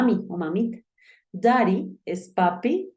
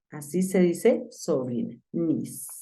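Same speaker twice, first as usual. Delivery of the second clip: ordinary talk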